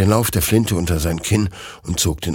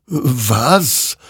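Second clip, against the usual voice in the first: wondering; 6 semitones higher, 4 dB louder than usual. Acted. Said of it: "wunderte sich dieser".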